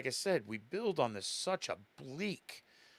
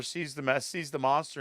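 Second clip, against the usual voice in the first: usual speaking voice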